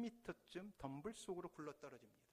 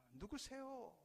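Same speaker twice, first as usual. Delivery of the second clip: puzzled question; 8 semitones higher, much slower than usual. Acted. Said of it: "어리둥절해서 묻습니다".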